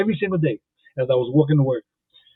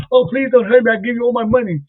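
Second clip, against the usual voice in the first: much higher, pleading mock terror; hammed-up frightened lady; 7 semitones higher than usual. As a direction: play the scared lady begging, hammed up.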